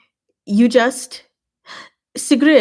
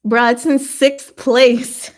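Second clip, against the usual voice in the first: dismayed surprise; 4 semitones higher, 7 dB louder, slower than usual. Real, not acted. Shocked at own ranking.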